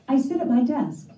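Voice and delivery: ordinary talk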